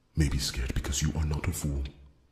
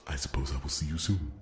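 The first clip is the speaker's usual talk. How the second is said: usual speaking voice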